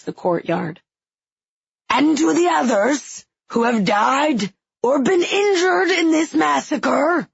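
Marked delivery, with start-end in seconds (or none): 1.9-7.3 put-on raspy old king. A narrator's reading. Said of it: "a voice like dead leaves scraping".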